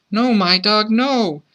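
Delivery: put-on high, alarmed child's cry. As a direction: cry out high to stop the dog. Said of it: "the child cried out".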